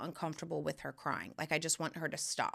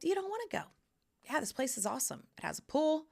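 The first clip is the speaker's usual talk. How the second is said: upbeat soothing mom; 6 semitones higher than usual. Retold or imitated. Mother soothing daughter, upbeat.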